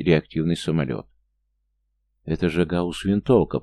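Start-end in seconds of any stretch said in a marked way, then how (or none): none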